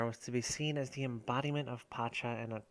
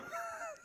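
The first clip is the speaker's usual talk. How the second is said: high squeal of laughter; 11 dB quieter than usual. Real, not acted. Group laughing after session.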